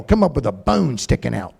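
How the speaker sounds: ordinary delivery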